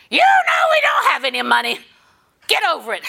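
very high, loud scolding shout; mother snapping. Retold or imitated. Mother in a department store shouting at her little girl. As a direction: bark it down at the child. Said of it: "And the mother said".

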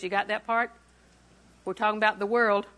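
ordinary delivery

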